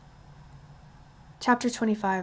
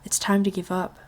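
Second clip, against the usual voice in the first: ordinary delivery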